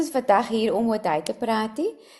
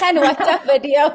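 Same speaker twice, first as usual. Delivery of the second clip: laughing; 4 semitones higher, 6 dB louder than usual. Real, not acted. Teasing story about Meryl, laughing.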